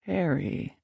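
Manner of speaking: softly repeated name, falling at the end; musing repeat. Acted. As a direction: turn the name over quietly, letting it fall. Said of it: "he repeated".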